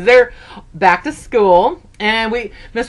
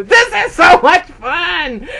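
slow, high, loud cry of joy; gleeful; 8 semitones higher, 4 dB louder, slower than usual. Retold or imitated. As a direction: shout your joy mid-race. Inner thought racing past.

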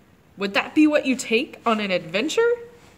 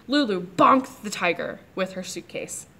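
plain reading voice

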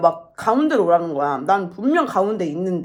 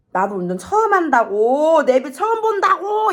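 drawn-out mock shout, climbing higher; retold yelling back; 8 semitones higher, 4 dB louder than usual. Daughter protests to dad it's her first time, mock shout. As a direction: imitate an exasperated beginner shouting back. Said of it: "이러고 또 소리 지르고".

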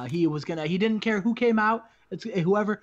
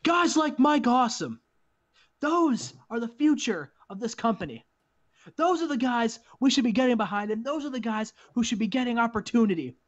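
higher, emphatic microphone speech; wrestler's promo; 5 semitones higher than usual. Acted. Raven endorsing Mike Awesome on the microphone, emphatic promo. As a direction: declare it to the crowd in punched phrases.

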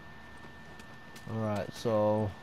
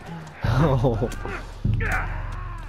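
high nervous laughter; 4 semitones higher than usual. Real, not acted. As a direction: laugh out the panic. Nervous laughter after fight.

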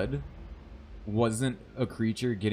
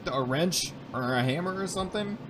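exasperated; 8 semitones higher than usual. Real, not acted. High complaint to the game.

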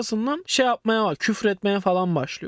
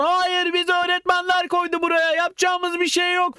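defensive students; 8 semitones higher than usual. Retold or imitated.

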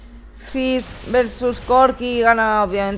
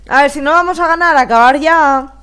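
exasperated; 4 semitones higher, 8 dB louder than usual. Real, not acted. Frustrated at losing.

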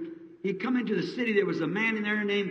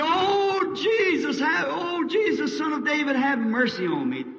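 crying out; 9 semitones higher, slower than usual. Retold or imitated. Blind beggar cries out to Jesus for mercy. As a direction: call across the crowd, begging. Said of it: "He cried".

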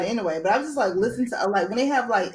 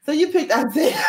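higher, louder, laughing through the words; laughing tease; 3 dB louder than usual. Real, not acted. Host reacting to the guest picking Isaiah, laughing tease.

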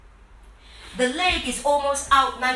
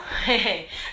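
short laugh; 4 semitones lower than usual. Real, not acted. Laugh between reps.

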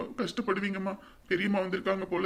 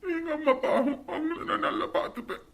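tearful promise; 8 semitones higher than usual. Acted. Child vows to care for mother.

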